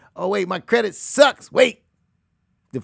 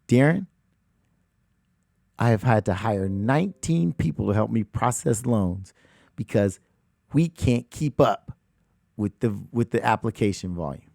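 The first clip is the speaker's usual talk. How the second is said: lightly voiced loan man; 7 semitones lower than usual. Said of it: "He said".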